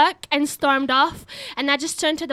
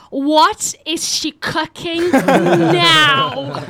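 loud, hugely drawn-out punchline; mock exasperation; 5 dB louder, much slower than usual. Flatmate waking to a fire, mock exasperated. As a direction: stretch the words in weary disbelief, breaking into laughter.

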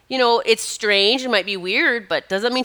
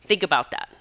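ordinary delivery

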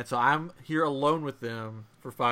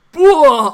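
explosive, high, loud "blah"; hammed-up retching shout; imitated vomiting; 13 semitones higher, 16 dB louder than usual. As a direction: mimic a sudden projectile puke. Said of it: "All over the floor".

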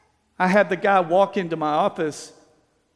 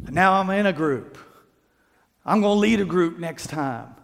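ordinary talk

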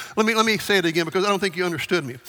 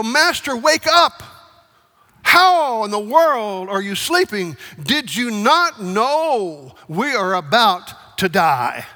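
panicked disciples; 2 semitones higher, 4 dB louder, slower than usual. Acted. Disciples yelling at sleeping Jesus, terrified and loud. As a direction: shout it like men sure they will drown.

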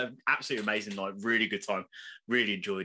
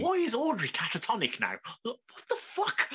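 baffled; 6 semitones higher than usual. Real, not acted. Fan asking why Audrey is catatonic, high stunned disbelief. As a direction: squeeze out a stunned high question.

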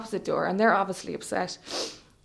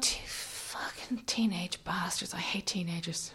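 slow, whispered curse; retold thought in a slow hush; inner seething; 4 semitones lower, 13 dB quieter, slower than usual. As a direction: whisper it venomously to yourself.

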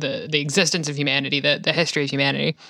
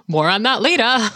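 high, loud teasing promise of more to come; mock ominous tease; 8 semitones higher, 5 dB louder than usual. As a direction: tease it like a cliffhanger. Teasing a later topic.

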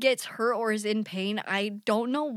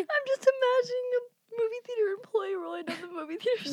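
high, crying with laughter; words squeezed out through laughter; laughing while talking; 10 semitones higher than usual. Real, not acted.

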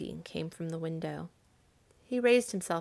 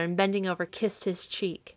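usual speaking voice

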